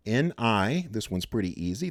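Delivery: ordinary talk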